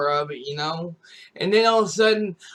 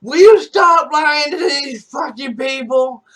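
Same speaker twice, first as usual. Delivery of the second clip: much higher, louder mock angry yell; mom yelling; 8 semitones higher, 9 dB louder than usual. Mom calls out her son's lies, angry yell. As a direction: scold him in front of the chat. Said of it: "my mom was like".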